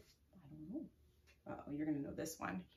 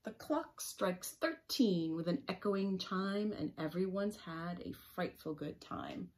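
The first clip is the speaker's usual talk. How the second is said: storybook reading; 6 dB louder, slower than usual. A narrator's reading.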